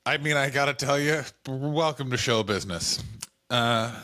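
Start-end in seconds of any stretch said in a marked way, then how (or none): none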